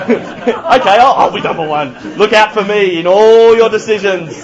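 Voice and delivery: loud, hammed-up declaration to all; mock self-important proclamation. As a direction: proclaim it loudly as number one, laughing at the act. Acting out being number one.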